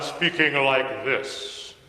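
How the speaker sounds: mock-solemn, stilted delivery; put-on formal Jesus, not like normal talk; unconversational Jesus. Shown as a demonstration. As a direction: parody a stiffly declaimed scripture voice. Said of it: "more conversational than that".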